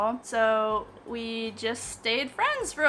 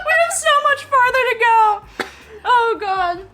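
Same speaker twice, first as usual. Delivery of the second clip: excited; 12 semitones higher, 11 dB louder than usual. Real, not acted. Partner struggling with spice.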